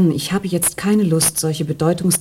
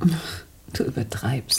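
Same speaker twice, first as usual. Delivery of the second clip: dismissive whisper; 6 semitones lower, 9 dB quieter, slower than usual. Acted. Patricia dismissing Jan.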